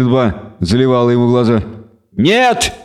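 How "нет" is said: much higher, bleating cry; the devil trying to stop the killing. A narrator's reading. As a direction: bleat the word in alarm. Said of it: "заблеял Торкви".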